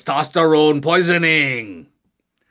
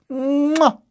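kiss sound; 7 semitones higher than usual. Acted.

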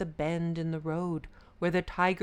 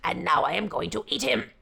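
louder gruff tiger voice; impatient hungry tiger; tiger growl; 3 semitones higher, 5 dB louder than usual. Acted. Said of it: "The tiger roared".

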